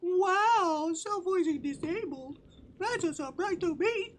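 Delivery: high, loud, squeaky mock shock; mock surprise. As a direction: squeal it in fake astonishment. Mocking the system voice.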